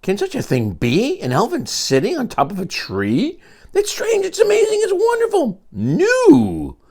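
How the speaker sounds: high amazed voice of hunters. Acted. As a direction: marvel at the sight.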